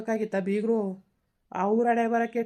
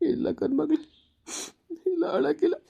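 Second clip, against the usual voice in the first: higher, sobbing denial; crying denial; 8 semitones higher than usual. Acted. Grandfather tells granddaughter he isn't crying, sobbing. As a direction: insist you're not crying while you sob.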